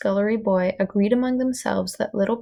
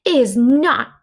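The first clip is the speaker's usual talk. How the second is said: defiant; 5 semitones higher, 8 dB louder than usual. Acted. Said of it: "said the princess".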